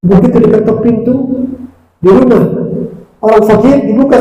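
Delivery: high, loud fervent sermon; fervent preaching. Acted. Preacher praising the grateful poor man, fervent and loud. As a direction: preach it with rising passion.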